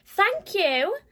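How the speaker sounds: tiny mice thanking the giant; squeaky thanks. Acted. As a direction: squeak it gratefully. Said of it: "they squeaked".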